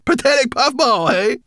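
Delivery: high, loud amused taunt; grinning brownie. A narrator's reading. Brownie teases Sorrel, high and amused. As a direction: grin through it.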